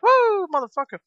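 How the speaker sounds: Ric Flair. Retold or imitated. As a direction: whoop it like a triumphant showboating wrestler. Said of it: "I feel like I'm Ric Flair".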